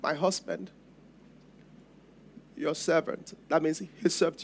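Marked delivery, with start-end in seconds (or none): 0.0-3.4 much softer, barely getting the words out through tears; tearful, broken words. Acted. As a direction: speak as a widow choking on her grief, low and soft. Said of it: "her tears are breaking out".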